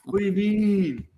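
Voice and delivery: high, laid-back Southern California bro drawl; Californian surfer accent. Pretend. Mocking his own Southern California roots, California surfer accent. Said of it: "I can have a California accent if I want to".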